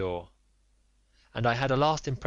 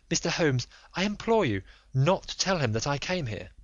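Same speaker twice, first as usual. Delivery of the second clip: higher, breathless lady's voice; a frightened aristocratic wife pleading; anxious noblewoman; 6 semitones higher than usual. Acted. Lady implores Holmes.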